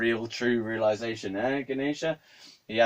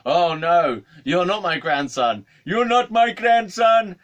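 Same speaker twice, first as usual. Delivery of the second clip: loud mock outburst climbing higher and slower; flipped-out old man; 10 semitones higher, 11 dB louder, slower than usual. Old man rejecting supposed grandson, flipping out. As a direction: imitate mounting hysterical rejection. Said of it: "he just flipped out".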